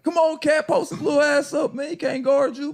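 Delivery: high-pitched retold courtside hype; urging a teammate on. Teammate urging Cat on in game.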